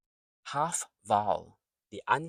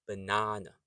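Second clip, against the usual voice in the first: "banana" in one smooth go, buh-NAH-nuh; said quickly. Shown as a demonstration. Teacher shows the quick version, "banana" joined up. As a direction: run "banana" together without a break. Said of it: "when you say it quickly".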